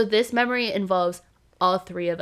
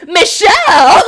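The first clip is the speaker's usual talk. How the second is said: loud accusing yelp of a name; retold blaming shout; 8 semitones higher, 13 dB louder, slower than usual. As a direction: shout the name to pin the blame. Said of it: "he just immediately turns and goes".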